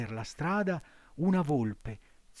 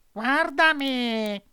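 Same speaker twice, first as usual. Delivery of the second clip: high, slow cat voice, echoing the fox; mournful mock lament; sly cat; 12 semitones higher, 8 dB louder, much slower than usual. A narrator's reading.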